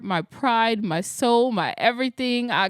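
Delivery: ordinary talk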